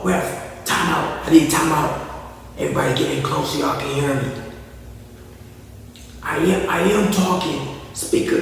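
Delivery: soft, muffled coach calling timeout; quiet voice that can't carry; mumbling Doc Rivers. Retold or imitated. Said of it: "Can't nobody hear him".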